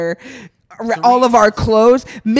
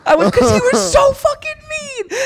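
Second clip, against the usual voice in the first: high, wailing complaint; worked-up indignation; 3 dB louder than usual. Real, not acted. Guest explaining her meltdown, shrill indignant wail. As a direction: protest in a high, half-laughing whine. Defending her outburst while laughing.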